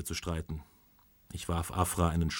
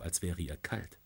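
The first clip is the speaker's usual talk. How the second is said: usual speaking voice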